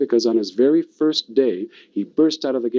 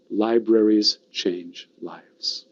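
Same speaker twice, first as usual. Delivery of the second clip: slow, spaced-out key phrase; measured slogan; much slower than usual. Shown as a demonstration. Speaker models the slogan for the audience, slow and deliberate. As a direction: give each word its own weight, very slowly. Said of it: "Say it with me".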